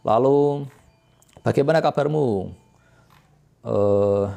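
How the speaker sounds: ordinary talk, nothing marked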